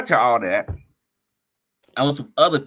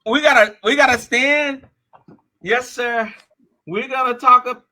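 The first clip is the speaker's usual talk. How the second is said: hyped-up pitch; 9 semitones higher than usual. Retold or imitated. Friend's eager pitch, retold.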